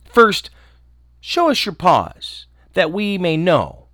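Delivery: put-on kids crying out. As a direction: insist on seeing the paws. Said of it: "The little kids cried".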